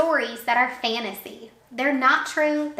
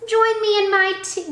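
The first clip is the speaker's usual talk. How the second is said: owl voice; 10 semitones higher, 5 dB louder than usual. Acted.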